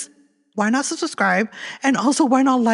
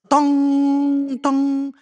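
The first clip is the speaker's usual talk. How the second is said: high, loud sung bong of a clock; clock chime; 6 semitones higher, 4 dB louder than usual. Pretend. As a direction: ring it out like a grandfather clock. Imitating the clock chime again.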